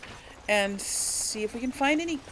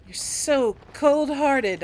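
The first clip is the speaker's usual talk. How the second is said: mock scolding; 5 semitones higher, 8 dB louder, slower than usual. Teasing companion over kitten remark.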